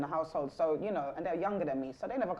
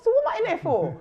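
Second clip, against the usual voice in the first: exasperated laughing mum; 10 dB louder than usual. Retold or imitated. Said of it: "I said".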